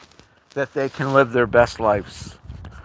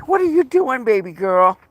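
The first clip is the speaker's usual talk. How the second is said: much higher cooing pet voice; 8 semitones higher than usual. Real, not acted. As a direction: coo at her like a puppy. High baby talk to dog.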